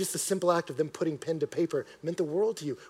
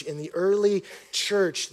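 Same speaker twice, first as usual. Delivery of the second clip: ordinary delivery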